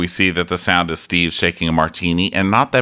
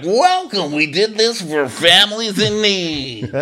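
high, slow hammy host voice; mock TV host; 12 semitones higher, slower than usual. Ty Pennington welcoming viewers to the house, hammy and high. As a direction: lay on the cheesy makeover-show welcome. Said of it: "Ty Pennington ... like".

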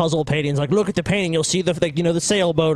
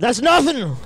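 put-on Scottish accent; 6 semitones higher, slower than usual. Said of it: "in a Scottish accent".

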